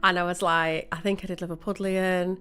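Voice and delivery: ordinary talk, nothing marked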